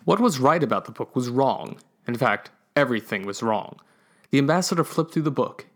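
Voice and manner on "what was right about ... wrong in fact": plain reading voice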